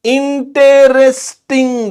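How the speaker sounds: इंटरेस्टिंग high, loud and drawn out, each syllable held; syllable-by-syllable demo. Shown as a demonstration. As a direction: sound out इंटरेस्टिंग slowly so the sounds can be counted. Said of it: "देखो कितने sound आ रहे हैं".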